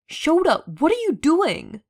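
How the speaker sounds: high, loud demand through the door. A narrator's reading. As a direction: call out sharply, demanding an answer. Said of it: "Hisashi demanded".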